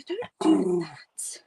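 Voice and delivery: slow hushed whisper. Real, not acted. Streamer hushing her dog, slow soft whisper. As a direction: breathe it quietly to the dog.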